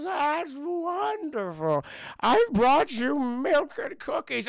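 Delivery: put-on high, very slow sweet old lady voice; mock old wife. Farmer's wife offering milk and cookies, high sweet mock voice. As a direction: dote on them like a grandma.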